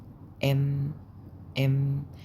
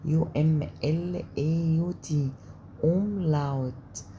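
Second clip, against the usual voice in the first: slow spelling; slower than usual. Shown as a demonstration. Teacher slowly spelling the word for students. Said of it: "أوملاوت اسمهم".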